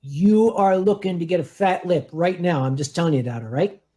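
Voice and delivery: low, menacing mock warning; deadpan low warning; mock threat. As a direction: play a low-voiced threat of a fight. Mock threat over Philly cheesesteak jab.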